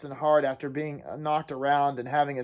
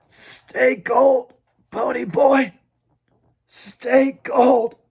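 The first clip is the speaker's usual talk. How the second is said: dying farewell; 9 semitones higher, 5 dB louder, much slower than usual. Acted. Johnny's dying words.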